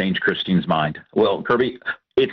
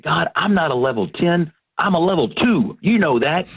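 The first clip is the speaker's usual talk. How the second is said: higher, pleading his case with God; pleading protest; 7 semitones higher than usual. Retold or imitated. Husband complaining to God about his wife, high pleading protest. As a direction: argue it like a wounded kid.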